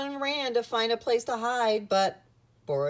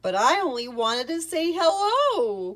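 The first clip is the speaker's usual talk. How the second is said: higher, louder bear voice calling after someone; 7 semitones higher, 6 dB louder than usual. A narrator's reading. Boris calling after the fleeing rats, calling out. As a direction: call it out to those running off. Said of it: "called Boris".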